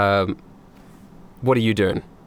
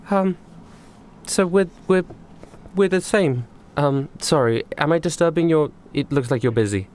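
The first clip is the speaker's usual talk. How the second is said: high, hesitant character voice; Goldie; 4 semitones higher than usual. Acted. Goldie interrupts busy Bradley, halting and apologetic. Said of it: "stop doing the fucking thing so people can understand".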